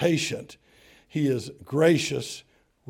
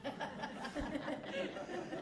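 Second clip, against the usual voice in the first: high unvoiced wheezing laugh; airy high giggle; breathy laugh; 11 semitones higher, 14 dB quieter than usual. Real, not acted. Laugh after story.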